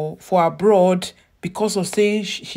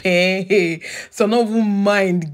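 ordinary talk, nothing marked

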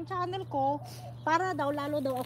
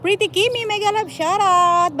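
excited shoutout; 6 semitones higher, 12 dB louder than usual. Real, not acted. Live shoutout to a viewer.

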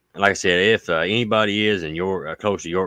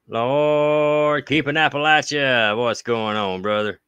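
excited call out; 8 semitones higher, slower than usual. Real, not acted. Calling out to viewer.

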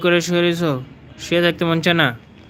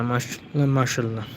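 tender murmur; 5 semitones lower, 5 dB quieter than usual. Real, not acted. Fond murmur over pet.

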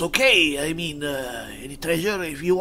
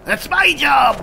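shout; 8 semitones higher, 7 dB louder, slower than usual. Real, not acted. Jokes he's the one who shouts.

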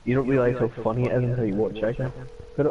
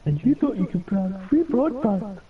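mocking impression; 8 semitones higher than usual. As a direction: ham up a friend's disbelief, pitched way up. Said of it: "I don't talk like that".

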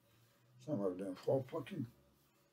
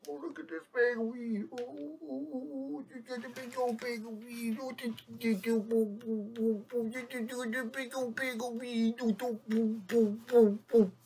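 much higher silly voice, gleeful; squeaky goofy voice; 10 semitones higher than usual. Pretend. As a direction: play a giddy cartoon character admiring a bag. Playful squeaky voice over weed.

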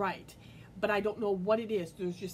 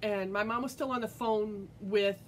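ordinary talk, nothing marked

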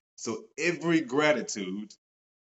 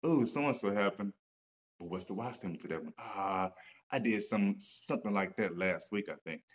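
musing to self; 4 semitones lower, 6 dB quieter than usual. Retold or imitated. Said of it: "I'm thinking to myself".

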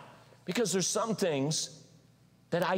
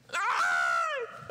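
desperate cry. Pretend. Preacher as Moses, wailing to the Lord. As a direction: wail it up to the sky. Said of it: "I'm like".

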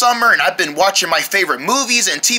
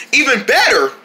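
ordinary talk

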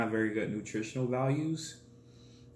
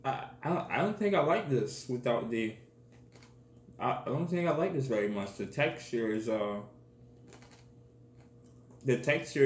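crunchy food between words; talking while chewing. Real, not acted. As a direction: mouth partly full of noodles. Seen 1: Holds bitten noodle block; jaw works between phrases, lips pressed.

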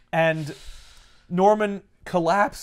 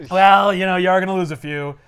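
mock loud; 3 semitones higher, 9 dB louder than usual. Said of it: "And he goes".

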